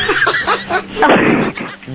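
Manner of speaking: high unvoiced giggle bursting out; burst of laughter. Real, not acted. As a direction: laugh at the idea. Friend laughs at slideshow wish. (tawang biglang sumabog)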